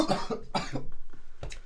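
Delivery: coughing fit from coffee; coughing, choking on a drink. Real, not acted. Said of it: "Sorry, I'm choking on my coffee".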